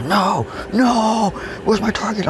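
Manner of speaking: high, frantic no, no; mock frantic protest. Dragged off by the coach, high frantic protest. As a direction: protest wildly while being pulled back. Pulled away mid-swing, retold.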